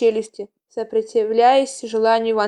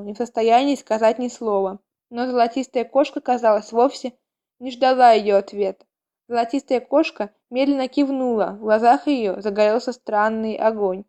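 usual speaking voice

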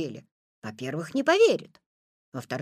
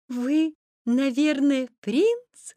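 put-on high, slow, girlish question; little girl; 5 semitones higher, 4 dB louder, much slower than usual. A narrator's reading. Girl asking if he is a prince, high wondering voice. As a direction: guess in a lifted, drawn-out voice. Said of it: "спросила девочка".

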